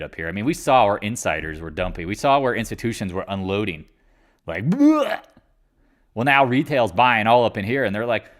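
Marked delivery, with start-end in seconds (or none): 4.7-5.2 high, loud retching bleh; mock bleh. Insiders dumping shares with a high loud bleh. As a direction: spit out a dismissive bleh.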